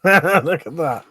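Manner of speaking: amused laugh-talk. Real, not acted. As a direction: giggle through it at the picture. Laughing at thumbnail.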